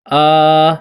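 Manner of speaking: long drawn-out vowel آ. Shown as a demonstration. Teacher demonstrating the long alif sound, drawn out. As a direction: stretch آ into a long open "aa". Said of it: "مانند این که آ،".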